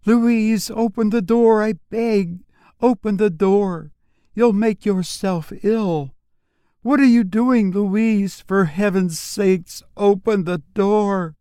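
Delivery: high pleading plea through a keyhole; high anxious sister pleading at a closed door; imploring sister. Acted. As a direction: beg her sister to open up, high and urgent. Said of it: "imploring admission".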